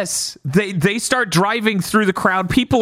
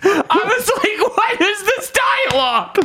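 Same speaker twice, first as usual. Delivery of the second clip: laughing disbelief; 12 semitones higher than usual. Retold or imitated. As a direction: squeal it through helpless laughter. Cracking up, retelling own disbelief.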